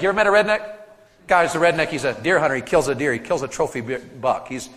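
usual speaking voice

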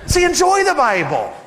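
ordinary delivery

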